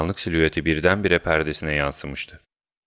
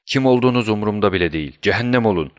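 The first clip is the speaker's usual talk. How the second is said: fast, raised, irritated outburst; angry roar; 4 semitones higher, 4 dB louder, faster than usual. Acted. Student to unwelcome visitors at his door, angry roar. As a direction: send them off with a quick angry bark. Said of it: "diye kükredi sinirli bir ses".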